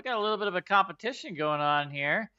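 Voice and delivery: high, loud, swaggering challenger; Juwila, brash warrior host. Acted. Juwila challenging the party to a strength contest: loud swagger. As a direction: taunt them into the contest.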